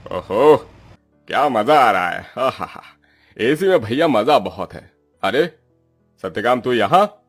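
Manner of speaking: luxuriating, swooping exclamations; theatrical pampered boss; self-satisfied landlord. Acted. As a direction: revel in the comfort, then turn surprised. Landlord basks in cool air.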